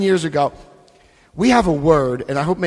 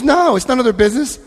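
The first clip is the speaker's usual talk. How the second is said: wife's answer; 8 semitones higher, 4 dB louder than usual. Retold or imitated. Wife answers his worried question.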